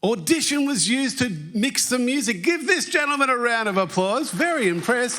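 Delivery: high, projected host announcement; upbeat prize-giver energy; excited applause call. Real, not acted. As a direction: hail a winning answer to the crowd. Host confirms answer, calls for applause.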